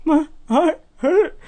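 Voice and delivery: very high, slow mock sob; super dramatic crying. Crying boy answering his baffled brother, super dramatic. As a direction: wail it out like a bawling kid. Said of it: "Super dramatic".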